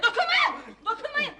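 high-pitched, urgent protest; agitated warding off. Acted. Agitated mother wards family off, high urgent cries. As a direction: keep them off with high cries.

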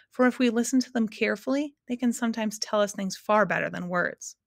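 ordinary delivery

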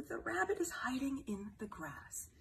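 hushed whisper about the hiding rabbit. Acted. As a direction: whisper it so the rabbit stays hidden. Whispered story line.

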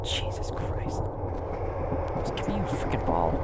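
hushed, breath-only grumble after a scare; shaken whisper. Real, not acted. Player recovering from the ghost's attack, aggrieved whisper. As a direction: whisper it, spent from screaming.

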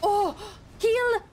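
high, sudden yelps of alarm; panicked yelp. Real, not acted. Hit in game, urgently needs healing.

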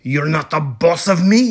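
put-on fast, loud kid's backtalk; quick defiant retort. A narrator's reading. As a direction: talk back to mama. Kitten defies his scolding mama.

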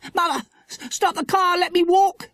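put-on high-pitched, urgent girl; stammered, high child's alarm; panicked begging. A narrator's reading. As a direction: plead to get away at once. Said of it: "begged Dinah".

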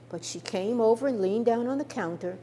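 usual speaking voice

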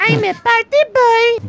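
sniveling toady. Pretend. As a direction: squeal it like a whiny sidekick. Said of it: "death metal impression there".

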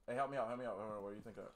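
ordinary talk, nothing marked

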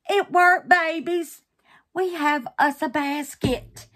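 loud, high, triumphant announcement; jubilant. Real, not acted. Crafter tells her babies it worked, jubilant. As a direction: proclaim the success with real joy. Celebrating the success with viewers.

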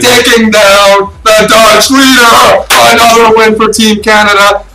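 loud, high, slow triumphant yell; excited shout. Real, not acted. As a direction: roar it out for the home team. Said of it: "too excited".

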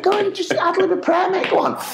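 very high-pitched egging on; squeaky, gleeful urging; urgent coaxing. Retold or imitated. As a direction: push a reluctant mate onto the stage. Urging colleague to ad lib prayer.

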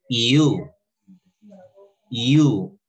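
"you" as a loud model word, oo accented; stress on the U. Shown as a demonstration. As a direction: hit the U of "you" hard. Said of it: "tiene acento en la U".

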